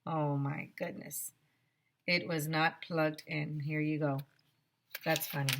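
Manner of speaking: plain reading voice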